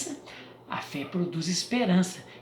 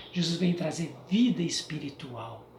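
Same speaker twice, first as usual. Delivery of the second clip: normal talking voice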